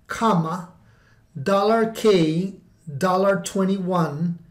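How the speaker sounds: dictating. Shown as a demonstration. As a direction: pause between each symbol. Reading out a formula.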